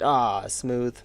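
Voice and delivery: usual speaking voice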